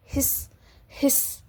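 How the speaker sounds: soft breathy snake hiss. Shown as a demonstration. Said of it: "snacks की sound कैसी होती है". (साँप की धीमी फुफकार)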